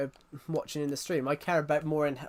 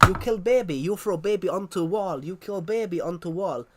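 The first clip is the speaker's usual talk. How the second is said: loud, hammed-up Russian accent of a schoolgirl; mock Russian accent; 3 semitones higher, 5 dB louder than usual. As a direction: shout it in a bad Russian accent, like a school drama piece. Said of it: "she's doing a Russian accent".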